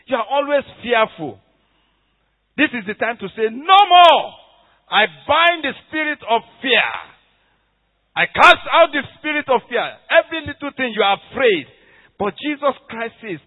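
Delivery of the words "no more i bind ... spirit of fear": loud, forceful rebuke of fear; commanding declaration. Pretend. Preacher leading the congregation to rebuke fear, loud and forceful. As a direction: cast fear out with authority.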